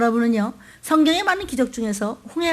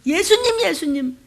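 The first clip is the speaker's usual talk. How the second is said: urgent high call to wake him; crying out; 6 semitones higher, 4 dB louder than usual. Acted. Disciples waking Jesus in the storm, calling out. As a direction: call out in alarm.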